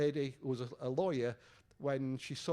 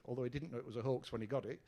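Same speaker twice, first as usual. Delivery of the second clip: normal talking voice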